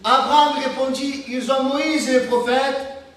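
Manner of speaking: solemn Bible reading from the pulpit; scripture reading. Acted. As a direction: lift the voice and read the verse like scripture. Reads the gospel passage aloud.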